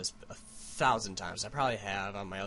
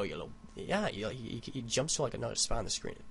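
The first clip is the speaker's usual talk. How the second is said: usual speaking voice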